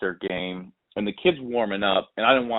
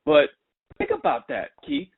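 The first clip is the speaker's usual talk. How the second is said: lightly voiced mom, higher throughout; a higher, lightly played mom making her point; mom's voice; 9 semitones higher than usual. Mom compares Tennessee and UC games, lightly voiced higher.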